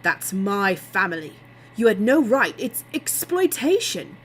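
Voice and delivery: indignant outburst. A narrator's reading. Guy angrily confronts Nick over family.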